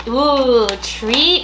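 ordinary delivery

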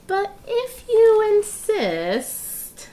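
high, very slow coy voice; milk the false modesty; coy accepting. Acted. Agent Lion accepting a reward after all, coyly.

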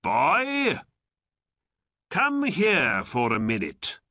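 loud call, starting very high. A narrator's reading. Old man calls Aladdin over, loudly. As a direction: hail a boy across the market. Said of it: "he called out to Aladdin".

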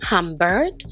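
ordinary delivery, nothing marked

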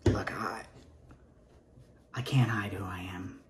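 breathy whispered plea; whispered heartfelt admission; whispered confession. Acted. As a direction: confess it in a hush.

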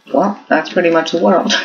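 put-on high voice; really high voice. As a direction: pitch it way up high. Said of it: "I changed from really high".

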